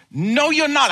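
high, loud no-nonsense retort; loud parental pushback; firm rebuke. Real, not acted.

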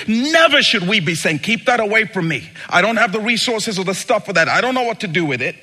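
high, loud, rushing refusal; loud preaching. Acted. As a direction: preach it, pushing it away. Preacher mocking fearful church.

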